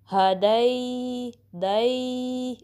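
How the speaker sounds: हदै and दै opening on a then gliding long to y; very slow, stretched diphthong in हदै; correct ya leen. Shown as a demonstration. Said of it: "ऐसी sound आएगी".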